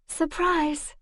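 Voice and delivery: mock-bright sarcastic greeting. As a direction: greet him with dry sarcasm. Sarcastic greeting to her captor.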